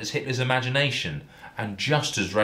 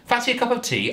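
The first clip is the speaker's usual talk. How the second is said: much higher, sing-song housewife offer; hammed-up Jill voice; faster than usual. A narrator's reading. Jill coyly offering Darren tea, high put-on voice. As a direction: play the eager hostess pitched way up. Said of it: "asked Jill".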